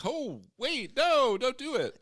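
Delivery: high, drawn-out mock panic; mock alarm. Narrator tries to stop a hanging, mock alarm. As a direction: plead in hammed-up alarm.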